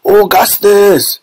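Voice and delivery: high, drawn-out mother's shout; slow high-pitched cry; Missus Gloop. A narrator's reading. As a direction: call out long and high to your son. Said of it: "shouted Missus Gloop".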